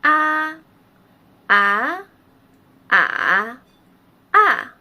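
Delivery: teacher's four-tone drill on 啊; four Mandarin tones on 啊. Shown as a demonstration. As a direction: demo 啊 through all four tones, each clearly shaped. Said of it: "今天我们一起学习四声".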